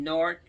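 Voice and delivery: Newark said the Jersey way, run together like "Nork"; Jersey pronunciation. Real, not acted. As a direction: say Newark as one quick local "Nork". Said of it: "we say Newark".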